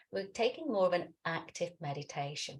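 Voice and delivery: ordinary talk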